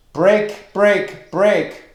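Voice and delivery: each "Break" high, loud and separate; every syllable stressed. Shown as a demonstration. Teacher reads poem line to show spondees, all stressed. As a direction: hammer break, break, break with equal weight. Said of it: "These are all stressed".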